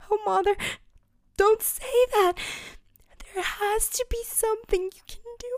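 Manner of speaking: high, fast, near tears; tearful girl. Acted. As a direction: hold back tears and plead.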